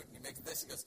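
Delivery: ordinary talk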